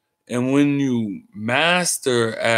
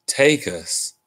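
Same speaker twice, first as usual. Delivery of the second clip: usual speaking voice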